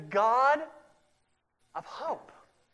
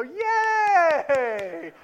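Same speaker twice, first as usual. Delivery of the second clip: put-on high, loud cheer for a toddler; mock delighted yay for a child; 8 semitones higher, 8 dB louder than usual. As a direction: cheer her on after the fall. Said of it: "we go".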